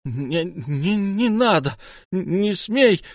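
high, panicked woman's cry; stammered high protest; frantic cry. A narrator's reading. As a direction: beg him to stop, voice climbing. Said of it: "что-то кричит".

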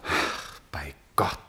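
low whispered oath; awed whisper. Acted. Prince whispers to the painter, overwhelmed by the likeness. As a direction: gasp it under your breath.

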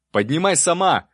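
high, loud yell over a siren. A narrator's reading. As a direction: bellow the order. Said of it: "заорал я".

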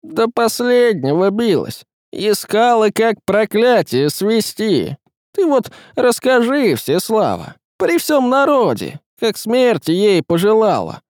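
high, forceful reproach; stern denunciation. A narrator's reading. Woman demanding the tsaritsa confess before all, stern. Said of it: "сурово сказала Ярогнева".

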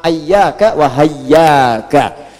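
slow "ayyaka", opening on a instead of i; fathah variant reading. Shown as a demonstration. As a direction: draw out "ayyaka wa hayyaka", both with an a vowel. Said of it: "ada juga yang baca pakai fathah". ("ayyaka wa hayyaka" pelan, diawali fathah)

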